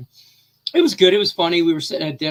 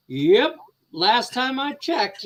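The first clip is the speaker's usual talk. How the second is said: slow, higher, deadpan mom comeback; dry mock assurance; 8 semitones higher, slower than usual. Mom brushing off her son's age question, slow and sly. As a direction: play it slow and sly, humoring the kid. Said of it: "And she's like".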